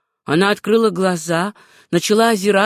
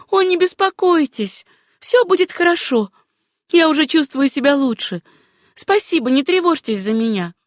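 markedly higher female character voice; reassuring heroine; 6 semitones higher than usual. Acted. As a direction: hurry to reassure him, pitched high. Plays Susanna reassuring the doctor.

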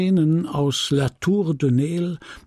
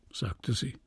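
usual speaking voice

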